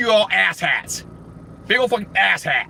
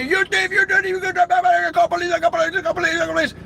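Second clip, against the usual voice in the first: high-pitched mock screaming gibberish; 13 semitones higher, faster than usual. Cashier runs out accusing customer of theft, mock screaming. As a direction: screech it out in a rapid unbroken high babble. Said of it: "screaming at me".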